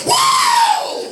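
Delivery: soaring high, loud jungle yell; full-throated mock Tarzan whoop. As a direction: swing out with a Tarzan cry. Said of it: "like Tarzan".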